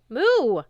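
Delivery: short, high, loud moo. Acted. The cow serpent pleading with Percy, a loud moo. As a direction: bellow a quick moo.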